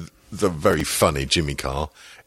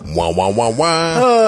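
muffled wah wah talk; 4 semitones higher, 5 dB louder, slower than usual. Pretend.

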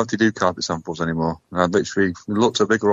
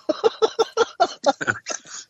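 high-pitched laugh. Real, not acted. Laughing at accent remark.

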